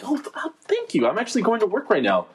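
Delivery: very high, sweet, eager voice; gushing politely at the barista; customer service voice. Retold or imitated. His own overly sweet replies.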